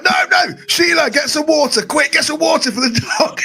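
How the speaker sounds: high, yelled panic at his wife; dad shouting to Sheila in a panic about the dog; panicked shout. Retold or imitated. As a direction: cry out for water like the dog is in danger. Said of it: "He was like".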